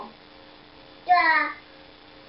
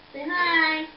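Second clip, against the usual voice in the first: usual speaking voice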